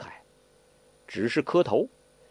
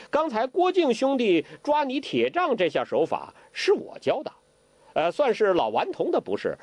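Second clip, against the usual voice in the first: put-on high, playful parroting of the old beggar; mock copycat apology; 8 semitones higher than usual. Zhou Botong parrots Hong's apology to Ke, impish. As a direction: echo his words with impish glee, much higher. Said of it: "照葫芦画瓢的说".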